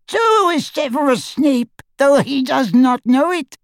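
high goblin voice. A narrator's reading.

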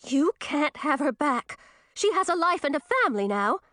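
put-on frightened girl defying the old witch, shaking. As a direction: voice wavering high with fear. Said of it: "Her voice was shaking as she said".

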